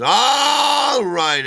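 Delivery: high, loud mic call over the crowd; booming DJ opener; DJ announcement. Retold or imitated. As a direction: grab the room's attention like a club DJ. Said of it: "and he says".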